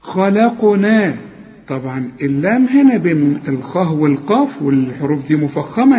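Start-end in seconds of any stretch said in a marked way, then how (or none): 0.0-1.2 ومن كل شيء خلقنا slowly chanted, noon hidden; recitation. Shown as a demonstration. Teacher models the verse, slow chant. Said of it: "قل لي".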